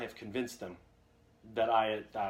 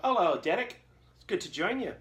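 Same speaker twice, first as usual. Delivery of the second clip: higher, louder put-on Scottish greeting; Scottish accent; 7 semitones higher, 4 dB louder than usual. Fan greeting Sylvester McCoy at Dragon Con, in Scottish accent. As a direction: greet him as a chipper Scotsman. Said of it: "I turned into a Scotsman".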